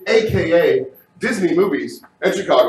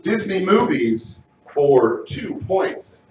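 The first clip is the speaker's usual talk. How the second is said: slow dramatic read; slower than usual. Acted. Host slow dramatic category read.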